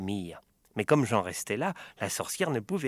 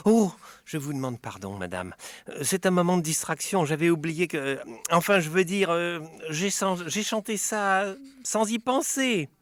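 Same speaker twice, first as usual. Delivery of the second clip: flustered apology; 7 semitones higher, 4 dB louder than usual. Acted. Flustered excuses to witch.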